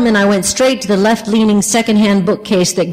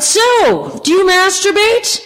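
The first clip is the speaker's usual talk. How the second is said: mock shout over bar noise; 11 semitones higher, slower than usual. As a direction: shout it across the noisy room. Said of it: "and she shouted".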